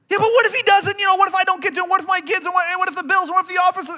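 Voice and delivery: high, loud, tumbling what-ifs; frantic worrying. Acted. Anxious believer arguing back at God, frantic. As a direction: spiral into hammed-up panic. Worrier interrupting God's calm.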